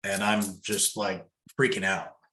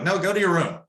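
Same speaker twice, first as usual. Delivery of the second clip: put-on stern parent shooing off a naughty kid, raised and higher; scolding a child; 5 semitones higher than usual. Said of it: "like a disobedient child".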